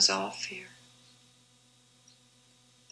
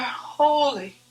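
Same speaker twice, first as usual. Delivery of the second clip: raised, reverent cry of holy, holy; crying out in awe; 2 semitones higher than usual. A narrator's reading. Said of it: "All I wanted to do was cry".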